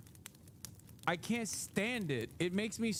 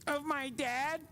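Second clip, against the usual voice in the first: mock whine; 9 semitones higher, slower than usual. Mocking the Don Junior quote.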